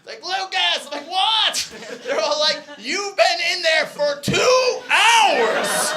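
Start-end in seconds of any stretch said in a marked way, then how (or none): 0.2-0.9 high mock shout; calling out. People calling through the door. 1.1-1.7 high, startled yell back; yelled reply. Retold or imitated. Answering through the bathroom door. 2.8-5.9 slow, high, loud shout; exasperated yell. Retold or imitated. Friends yelling through the door.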